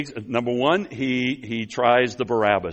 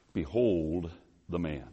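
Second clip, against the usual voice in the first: slow, low and soft; solemn presentation; 5 semitones lower, 8 dB quieter, slower than usual. Acted. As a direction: present him with slow, hushed gravity. Pilate dramatically presents Jesus.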